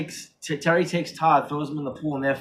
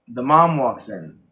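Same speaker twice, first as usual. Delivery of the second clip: ordinary talk, nothing marked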